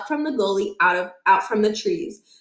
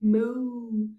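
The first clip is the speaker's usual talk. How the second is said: moo; 6 dB quieter than usual. A narrator's reading. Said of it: "a cow mooed".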